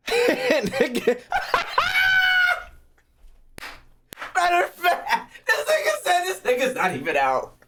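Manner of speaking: high, cracking laugh through the words; can't stop laughing at the news; laughing disbelief, voice way up. Real, not acted.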